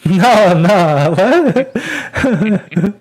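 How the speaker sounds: real laughing, high and loud; laughing protest. Real, not acted. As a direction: object through a burst of laughter. Laughing at player's gibberish Dwarvish.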